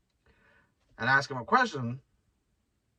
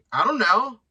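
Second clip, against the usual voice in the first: mock innocent; 8 semitones higher, 7 dB louder than usual. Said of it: "And I'm like".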